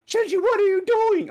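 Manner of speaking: high, loud acted scolding; alarmed mock shout. The others confronting Shinji as he leaves, high alarmed mock shout.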